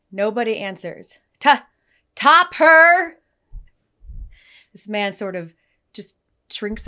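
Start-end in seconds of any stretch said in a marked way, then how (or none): 1.4-3.2 stumbling high shout, split in two; loud, halting counter-worker yell; yelled name call. Retold or imitated. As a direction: holler the order name, sounding it out. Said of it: "she yells out".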